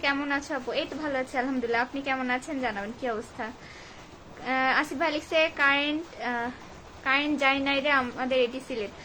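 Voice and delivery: ordinary talk, nothing marked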